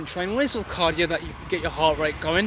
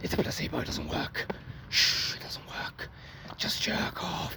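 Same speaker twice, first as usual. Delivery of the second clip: soft sly whisper, much softer; whispering temptation; 6 dB quieter than usual. Retold or imitated. The mind whispering to a viewer to relapse, hushed and sly. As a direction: hush it like a devil on your shoulder. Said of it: "your mind tries to trick you and say".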